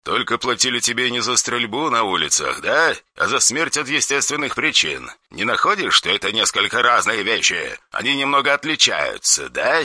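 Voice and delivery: soft, cold menace; quiet threatening caller on the phone; dry harsh voice. Acted. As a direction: threaten without raising the voice.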